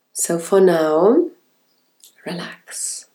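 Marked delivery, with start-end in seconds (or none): 2.2-3.1 breathy whispered relax. Acted. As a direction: breathe the word out, unvoiced. Whispered cue in meditation.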